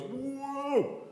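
sudden high yelp of awe; startled whoa. Retold or imitated. Preacher voicing Isaiah before God, startled whoa. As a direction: gasp it out, thrown back by the sight. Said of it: "And then God appears and he goes".

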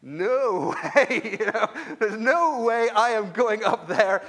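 no way, you're not getting me up there; hammed-up dread of karaoke; mock horrified refusal.

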